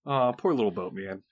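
low, mock-pitying tease; gentle teasing. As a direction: coo at him with fake pity. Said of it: "Dag said teasingly, tongue in cheek".

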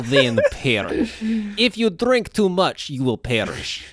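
deliberate Russian threat; Russian accent. Acted. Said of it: "that's exactly what I heard".

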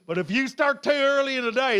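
ordinary talk, nothing marked

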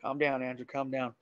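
very soft, low reassurance; hushed self-calming. Retold or imitated. Camper hearing footsteps tells himself, hushed and steadying. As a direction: talk yourself down under your breath.

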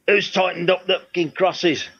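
gruff rock star growl. Retold or imitated. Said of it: "That was a great impression of it".

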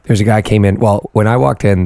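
ordinary delivery

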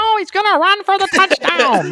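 high, loud, nasal northeastern US announcer; mock a shrill nasal Northeastern accent at full volume; nasally northeastern accent. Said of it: "the nasally northeastern accent".

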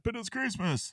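high, pleading protest; mock high plea. Salvation Army people pleading with the valet, mock high plea. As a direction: whine it up high like a wounded do-gooder.

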